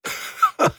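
low-key, voiceless husky chuckle; hoarse laugh escaping the throat; quiet hoarse laugh. A narrator's reading. Captain trapped in a star, quiet hoarse laugh. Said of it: "drang ein leises, heiseres Lachen".

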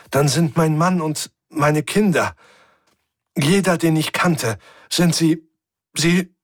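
Stimme bricht, höher und lauter; stockend, bis die Stimme versagt; voice breaking. A narrator's reading. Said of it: "Die Stimme versagte ihr den Dienst". (mit brechender Stimme, deutlich höher)

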